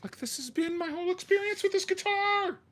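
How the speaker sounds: loud, very high-pitched frustrated yell; explode, voice shooting way up; exasperated outburst. Real, not acted. Venting about the whole guitar experience, exasperated yell. Blows up over smashing the guitar.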